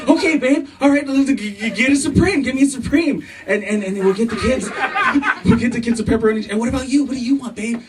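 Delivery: high-pitched, excited husband act; giddy retold generosity; happy, eager husband. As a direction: gush happily, tripping over your own words. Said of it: "So I was happy that I said".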